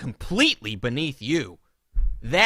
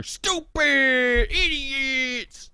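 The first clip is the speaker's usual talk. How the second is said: mock angry ranter; 10 semitones higher, much slower than usual. Mocking Dave's Twitter rants.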